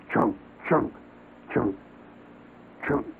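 spaced chunk sounds, one axe stroke each; steady chopping sound. Retold or imitated. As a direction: chop out each chunk with a pause between. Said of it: "somebody does when they're chopping wood".

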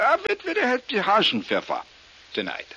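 high-starting German waiter voice; mock German waiter accent. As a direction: answer in hammed-up German. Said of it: "everything I say is in a German accent".

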